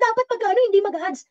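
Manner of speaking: high, sing-song mock of the viewers. Mimicking viewers who say he shouldn't have ads, high and mocking. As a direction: parrot the complaint in a silly voice. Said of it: "pag sasabihin nyo".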